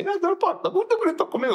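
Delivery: high-pitched mock protest; retold yelling back. Man retelling how he answered a street heckler, mock yelling. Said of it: "eu gritei com ele".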